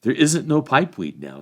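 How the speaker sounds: a hint of rustic hobbit complaint. A narrator's reading. Hob telling the travellers about pipeweed, lightly voiced rustic hobbit.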